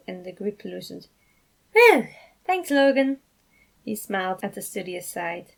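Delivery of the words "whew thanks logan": relieved whew. Acted. Relieved after tight hug.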